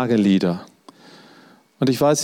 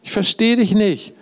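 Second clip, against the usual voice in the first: pleading cry, pitched up high; a high, pleading protest to a friend; lament to God; 8 semitones higher, slower than usual. Shown as a demonstration. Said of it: "Wir können ihm sagen".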